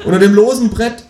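high, loud cry of surrender; desperate confession. Acted. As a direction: cry out the hiding place, beaten. Desperate confession under interrogation.

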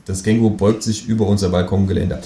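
plain reading voice

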